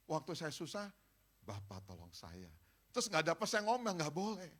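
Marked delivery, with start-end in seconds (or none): 1.4-2.5 soft, lower pleading prayer voice; a meek whispered-like prayer for money; quiet humble plea. Retold or imitated. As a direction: beg God humbly and softly. Humble prayer quoted again.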